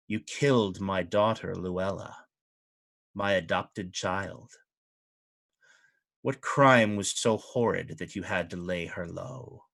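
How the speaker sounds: a touch of cold grief in a lordly voice; quiet, measured accusation from a noble; softly voiced duke asking about his daughter's death; grieving fey duke. Duke mourns his adopted daughter.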